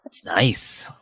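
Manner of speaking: put-on hushed Boston accent; imitated heavy Boston accent, half-whispered. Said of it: "heavy Boston accent".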